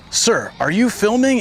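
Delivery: a put-on stern monk asking; imitating a stern monk. Monk confronting him.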